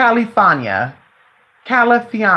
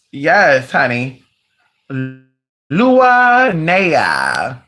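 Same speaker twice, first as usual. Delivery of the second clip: ordinary delivery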